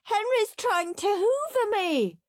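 high tattling wail. A narrator's reading. Peter telling mum on Henry, wailed. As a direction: wail it in childish alarm. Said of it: "wailed Peter".

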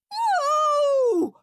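long high loud howl. Acted. Henry howling at Mum about hoovering. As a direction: howl it like a child in agony. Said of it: "howled Horrid Henry".

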